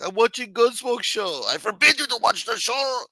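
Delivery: very high, put-on voice for a hammed-up row; exaggerated high-pitched domestic outburst; mock family quarrel. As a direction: play the father-son argument way up high. Acts out father-son row over show.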